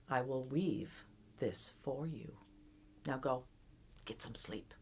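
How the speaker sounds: a hint of a crone sinking to a whisper; old woman hushing. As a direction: send the girl off to sleep in a hush. Old woman sending girl to sleep.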